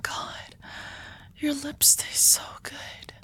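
hushed, breathy confession to a lover; soft ASMR whisper; intimate whisper. Acted. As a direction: whisper it close to the ear, afraid to lose them. Whispered kiss scene, ASMR.

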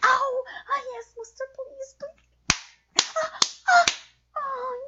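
high, loud yelps and gasps; cries of pain and pleasure. Acted. Sub crying out as master spanks her, high loud yelps. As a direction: cry out at each strike.

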